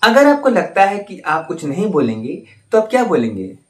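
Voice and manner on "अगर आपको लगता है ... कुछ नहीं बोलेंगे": ordinary talk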